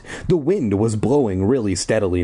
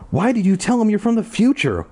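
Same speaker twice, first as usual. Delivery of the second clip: exasperated high question; 11 semitones higher than usual. Acted. Paul confused and exasperated.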